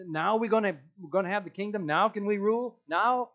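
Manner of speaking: mock-impatient disciples demanding now; eager disciples. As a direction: pester like eager kids. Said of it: "disciples are trying to put their plan".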